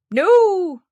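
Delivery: very high, slow taunting no; squeaky little man. Acted. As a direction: gloat over her wrong guess.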